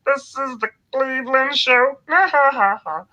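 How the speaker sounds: high-pitched cartoon theme-song voice; Cleveland impression. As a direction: sing out the show theme high and loud, ending in laughter. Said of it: "So there's Cleveland".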